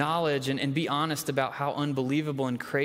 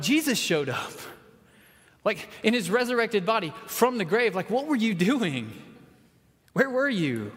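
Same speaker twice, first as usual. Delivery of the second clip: excited disbelief; 6 semitones higher than usual. Retold or imitated. Disciples telling Thomas what he missed, excited and high. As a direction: burst out with the news they missed.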